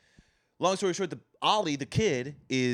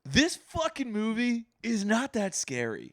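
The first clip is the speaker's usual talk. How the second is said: high-pitched, drawn-out verdict; emphatic verdict; 9 semitones higher than usual. Real, not acted.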